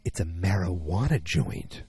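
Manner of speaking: low, deliberate mock-serious announcement; name it like a clueless square; mock-naive explainer. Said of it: "terms of some guy who really doesn't know anything".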